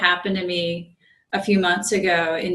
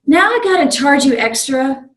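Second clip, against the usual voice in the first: higher, louder imitation of a scolding conductor; stern conductor; 6 semitones higher, 6 dB louder than usual. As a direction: lay down the penalty like a gruff official. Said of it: "And he's like".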